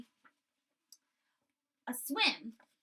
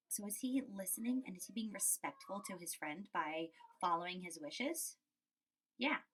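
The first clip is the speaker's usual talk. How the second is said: ordinary talk, nothing marked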